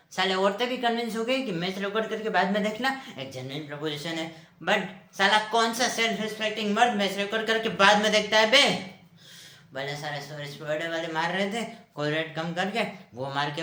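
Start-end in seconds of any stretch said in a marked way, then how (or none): 4.6-9.0 loud rant swelling to the end; indignant outburst. Acted. Man defending live match viewing, indignant and loud. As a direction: build to an outraged rhetorical question.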